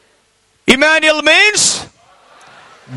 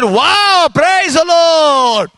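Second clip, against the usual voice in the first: drawn-out, delighted exclamation; amazed praise; much slower than usual. Retold or imitated. Preacher hears auntie has no worries, amazed praise. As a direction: stretch out the praise in happy amazement. Reacts to auntie's good news.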